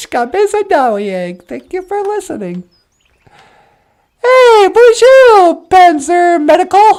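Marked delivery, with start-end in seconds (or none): 4.2-6.9 slow, loud, high hello; loud greeting. Acted. Host hailing the audience, slow loud greeting. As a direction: call out the greeting big.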